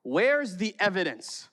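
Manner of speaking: put-on a boy arguing back at Mom; mad kid. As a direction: demand proof like an angry child. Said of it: "I remember being so mad".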